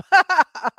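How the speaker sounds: laughing at the cab bickering. Real, not acted. Friend laughs about the cab bickering, short real laugh.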